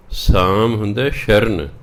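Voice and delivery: usual speaking voice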